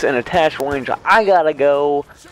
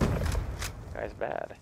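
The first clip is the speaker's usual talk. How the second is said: slow whispered taunt; mocking whisper; 6 semitones lower, 13 dB quieter, much slower than usual. Real, not acted. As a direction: breathe it out slowly to mock.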